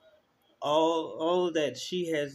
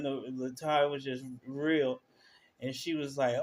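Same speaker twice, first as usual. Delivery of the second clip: normal talking voice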